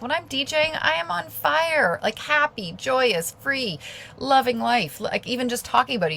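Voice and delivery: lifted, high-pitched joyful enthusiasm; light up with joy, pitch riding high; audibly excited about what you love; real excitement. Real, not acted. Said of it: "you can hear the excitement in my voice".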